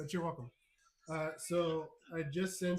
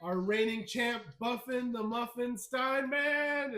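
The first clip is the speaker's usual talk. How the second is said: champion introduction, building higher and stretching the name; ring announcer; 4 semitones higher than usual. Acted. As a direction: announce the champ, drawing out the last words. Host introduces the champion to crowd.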